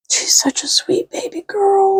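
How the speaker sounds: high whispered adoration; doting whisper. Real, not acted. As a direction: coo over your dog softly. Doting on the dog.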